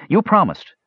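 put-on high-pitched insistent kid; pushy child claiming a promise; bold child. A narrator's reading. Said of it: "the child demanded boldly".

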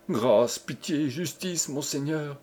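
soft, broken-up pleading; hushed, desperate appeal; imploring cry. A narrator's reading. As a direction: beg on your knees. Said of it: "cria Jeanne en tombant à genoux".

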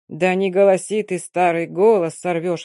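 ordinary delivery

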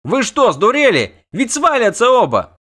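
very high, loud yell; outraged shouting. A narrator's reading. Bard berates rescuers as both may fall, outraged shouting. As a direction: shout at them in disbelief. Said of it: "кричал Лютик".

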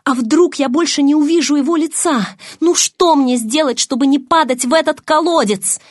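mounting panic; panicked. A narrator's reading. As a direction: fret desperately while slipping away. Heroine panics as she slips away.

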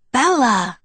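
Bella cried out high and loud; exclaiming. A narrator's reading. Jacob recognizes Bella on the phone, exclaiming. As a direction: exclaim the name in sudden recognition. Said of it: "he exclaimed".

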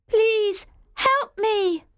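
put-on scared child crying for help; frightened shout. Locked-in Jane begs for help, terrified. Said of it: "I shouted".

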